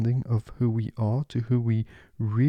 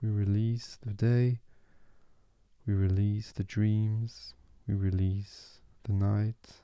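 guided meditation. Acted.

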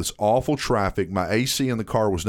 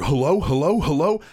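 impatient caller; 3 semitones higher than usual. Acted. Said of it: "They'll go".

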